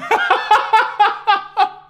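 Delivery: high, loud burst of laughter; laughing hard. Real, not acted. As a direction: lose it laughing. Laughs at size comparison.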